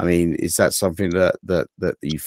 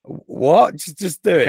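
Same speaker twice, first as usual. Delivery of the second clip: high-pitched, put-upon retort; hammed-up, higher-pitched insistence; mock exasperation; 10 semitones higher, 4 dB louder, slower than usual. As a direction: act out a pestering back-and-forth. Replays pestering exchange with his kids.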